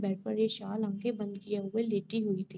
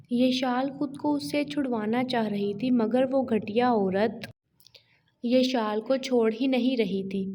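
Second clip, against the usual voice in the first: loud tense narration; 5 dB louder than usual. A narrator's reading. Narrator reading the fight scene to listeners, loud and tense. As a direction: narrate the fight loudly, pushing each phrase.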